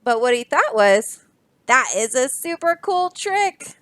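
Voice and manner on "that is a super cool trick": Dog's thoughts. Acted.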